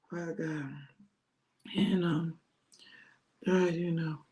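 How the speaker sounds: low, drowsy prayer trailing away; dozing off praying. Acted. Disciples drifting off while praying.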